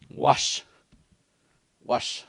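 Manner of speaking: wash said on its own, slow and clear; wash, first part of the word said alone; teaching demo. Shown as a demonstration. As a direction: give the syllable wash by itself, carefully. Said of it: "Get used to saying that part there".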